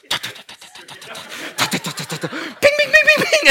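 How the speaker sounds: high mock radar ping, repeated; ping like a radar locking on; playful high-pitched radar beeps. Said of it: "My radar's like".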